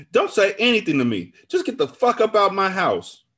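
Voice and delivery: high, loud furious order; angry dismissal. A narrator's reading. Friend throws betrayer out of house, furious.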